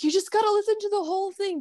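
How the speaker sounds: high, quick breathless urging; worked-up pleading. Retold or imitated. Fan urging unimpressed friends to try it, worked-up pleading. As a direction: plead with them, pitch way up and fast. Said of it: "I run out of words, and I'm like".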